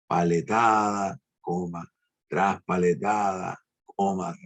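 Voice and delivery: slow, marked recitation. Shown as a demonstration. Teacher reciting a sad burial poem, slow and marked. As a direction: draw out "Paletada" and "tras paletada", pausing at each comma.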